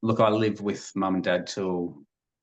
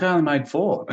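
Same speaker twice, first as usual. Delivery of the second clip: mock indignant protest; 9 semitones higher, 3 dB louder than usual. Retold protest to dad.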